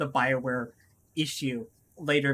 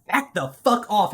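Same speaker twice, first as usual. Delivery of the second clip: mock angry snap; 4 semitones higher, 8 dB louder than usual. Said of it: "your character turns around and goes".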